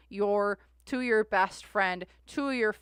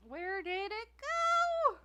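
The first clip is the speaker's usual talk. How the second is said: stretched-out high question, slow; drawn-out high whine of confusion; high puzzled wail; 14 semitones higher, much slower than usual. Real, not acted. Searching for lost notebook.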